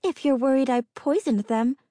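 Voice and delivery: put-on high, timid young woman; eager nervous girl, pitched high. A narrator's reading. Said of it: "Inga tried again".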